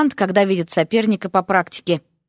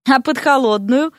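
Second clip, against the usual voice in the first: higher-pitched puzzled question; mildly taken aback, pitch lifted; surprised; 5 semitones higher than usual. A narrator's reading. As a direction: ask with innocent surprise. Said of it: "удивилась Вася".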